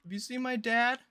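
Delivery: put-on high, loud little-kid question; lost kid. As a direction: call out like a child lost at the mall. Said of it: "I'm the kid that wants to wander around".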